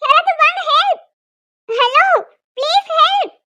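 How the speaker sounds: frightened cries for help; calling for help. Acted. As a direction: shout for help from inside the lift. Trapped in stopped lift calling.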